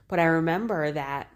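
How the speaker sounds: ordinary delivery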